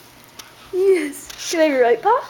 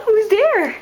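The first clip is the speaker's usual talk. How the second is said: higher-pitched excited dog talk; playful excitement; 6 semitones higher, 3 dB louder than usual. Real, not acted. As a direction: get the dog excited about a visitor. Exciting the dog about someone arriving.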